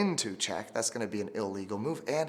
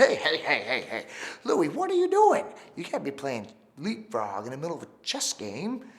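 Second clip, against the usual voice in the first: loud, very high scolding king voice; king Francis scolding Louis across the board; 3 semitones higher, 4 dB louder than usual. Acted. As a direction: call him out like a cross squeaky king.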